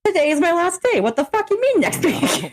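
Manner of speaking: high-pitched disbelief, breaking into laughter; retold indignant squawk; incredulous outrage. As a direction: protest in laughing exasperation. Retelling her outraged reply to manager.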